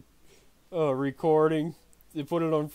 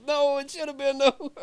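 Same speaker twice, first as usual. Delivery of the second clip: high joking reply; 11 semitones higher than usual. Retold or imitated. Mock reply, voice goes high.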